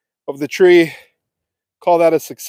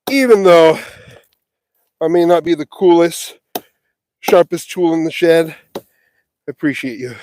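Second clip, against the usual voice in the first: normal talking voice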